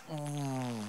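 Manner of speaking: soft low groan of disgust; a quiet queasy ooh; pained ooh. Retold or imitated. Guest biting into a strange dish, soft disgusted ooh. Reaction on crunching into food.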